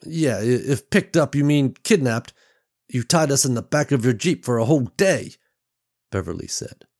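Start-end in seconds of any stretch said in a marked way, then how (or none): 0.1-5.3 louder, higher indignant retort; indignant huff. Acted. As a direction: throw the word kidnapped back at him. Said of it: "Beverly huffed".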